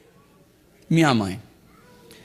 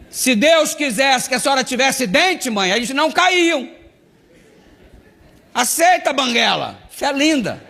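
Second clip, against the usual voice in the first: mocking preachy voice; 7 semitones higher than usual. Son keeps mocking mother.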